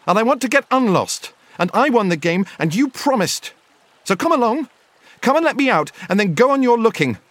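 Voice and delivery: high-pitched, insistent character voice; Bilbo crying out. A narrator's reading. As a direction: press the demand in a high voice. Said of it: "cried Bilbo".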